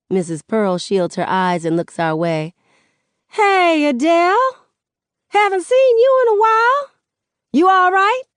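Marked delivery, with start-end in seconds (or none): 3.3-8.2 very high, loud friendly holler; calling to neighbor. Acted. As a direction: call across the yard, rising into questions. Neighbor calls back across the street.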